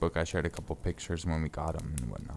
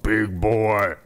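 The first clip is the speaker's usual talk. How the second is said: excited exclaim; 3 semitones higher, 11 dB louder than usual. Real, not acted. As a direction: cheer it out like a proud owner. Admiring the big cat.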